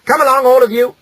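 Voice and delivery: put-on high, loud call to the children; rounding up the whole brood; calling the family. A narrator's reading. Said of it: "she said to her husband".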